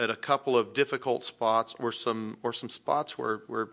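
ordinary delivery

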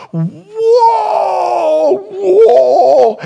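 high, loud drawn-out "whoa"; awed shout. Retold or imitated. Pastor stunned by the best guitar, loud awed whoa. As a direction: cry out in astonishment. Third guitar even better.

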